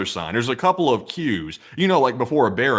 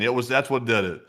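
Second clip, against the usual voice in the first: ordinary delivery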